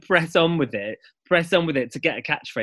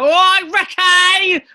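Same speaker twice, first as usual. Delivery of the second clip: drawn-out, much higher and louder call across the street; EastEnders shout; 9 dB louder, much slower than usual. Retold or imitated. Fan calling out to an EastEnders actor, loud drawn-out shout. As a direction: yell it like a fan spotting a soap star. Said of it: "people see someone from EastEnders".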